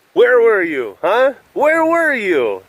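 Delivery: hammed-up gloating jab; mock taunt. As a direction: rub it in like a smug fan. Needling rival fans.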